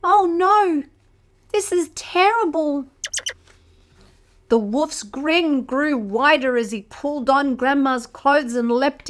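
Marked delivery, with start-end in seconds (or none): 0.0-2.8 high worried cry; alarmed. Acted. Reader alarmed for Grandma.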